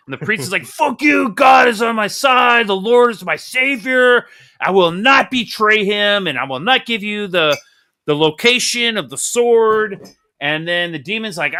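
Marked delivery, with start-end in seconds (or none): 0.7-10.1 loud, high defiant yell at a demon; defiant shout. Acted. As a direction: shout it like a martyr refusing to talk. Priest defying demon, acted quote.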